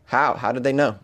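normal talking voice

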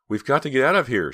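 loud, high, panicked shout; urgent cry. A narrator's reading. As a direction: cry out to flee right now. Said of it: "she cried".

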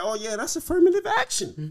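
high, loud mock realization; exclaimed reaction. Fan retelling his reaction to the award, exclaimed. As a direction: call it out high, as if catching on. Quotes own reaction to MVP talk.

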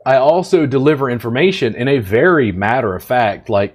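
ordinary delivery

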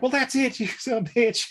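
high put-on kid voice; angry kid. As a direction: play a riled-up kid, half laughing. Retold kid threatens captain.

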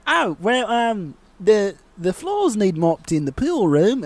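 high, put-on polite woman's voice; mock the coach's sweet question; Australian swim coach asking politely, high; imitating the swim coach. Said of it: "she's like".

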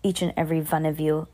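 ordinary delivery

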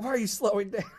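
high, playful protest; mock kid complaint. Kid to mother as the car slows, mock complaint.

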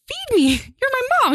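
high squeaky chirping voice; baby bird voice. Pretend. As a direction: beg like a hungry baby bird. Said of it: "she would be like".

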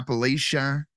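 Appalachia with a long LAY in the third syllable; contested pronunciation. Real, not acted. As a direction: say Appalachia as app-uh-LAY-shuh. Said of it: "No, it's Appalachia".

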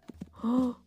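sharp gasp of shock; frightened gasp. Acted. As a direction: gasp in sudden alarm. Thief realizes he rides a tiger.

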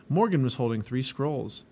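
ordinary delivery

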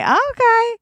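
a startled, squeaky high okay. Retold or imitated. Startled by his creepy line, squeaky high okay. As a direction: pitch the okay way up, taken aback. Reacting to weird compliment.

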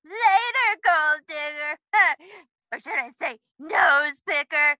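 taunting. Acted. Child taunts Kitty.

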